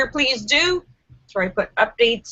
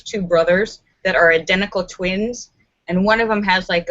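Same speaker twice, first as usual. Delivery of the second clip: ordinary delivery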